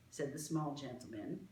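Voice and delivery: usual speaking voice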